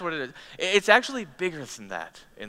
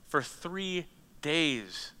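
slow, drawn out; 2 semitones higher, 5 dB quieter, much slower than usual. Real, not acted. Lingers on the long journey.

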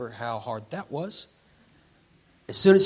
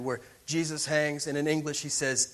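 ordinary talk, nothing marked

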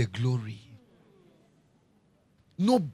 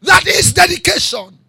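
much higher and louder, slow shouted phrases; shouted preaching climax; 13 semitones higher, 14 dB louder, slower than usual. Acted. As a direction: roar it at the congregation. Climax over music.

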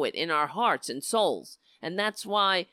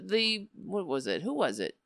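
usual speaking voice

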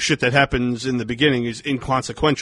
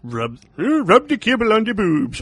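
eccentric director voice; 9 semitones higher, 3 dB louder than usual. Retold or imitated.

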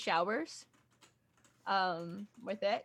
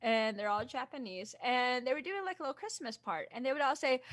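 ordinary talk, nothing marked

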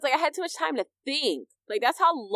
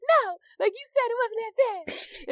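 upset protest; 12 semitones higher than usual. Retold or imitated. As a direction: protest it, still rattled. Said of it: "I'm just like".

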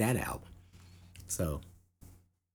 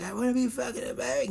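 high whiny rocker voice; 13 semitones higher, faster than usual. Retold or imitated. Imagining Ozzy wanting to be American, high whine. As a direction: whine it like a spoiled rock star. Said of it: "He's probably like".

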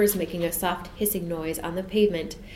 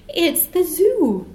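slow, much higher and louder in wonder; amazed; 10 semitones higher, 7 dB louder, much slower than usual. A narrator's reading. Jane shows Michael the zoo, slow amazed discovery. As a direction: stretch it out in wonder.

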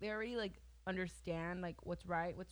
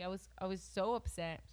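ordinary talk

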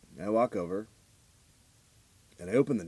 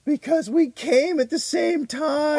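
slow, much higher and louder put-on voice; mock stepmother; 6 dB louder, slower than usual. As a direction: proudly declare it in a strange high voice. Retold stepmother answering cops.

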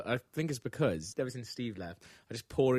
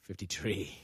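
Irish accent; 4 semitones lower, 6 dB quieter, slower than usual. Shown as a demonstration. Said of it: "say in an Irish accent".

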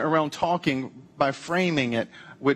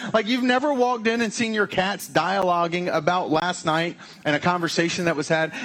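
ordinary delivery

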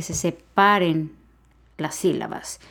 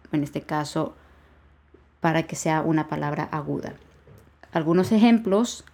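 ordinary delivery

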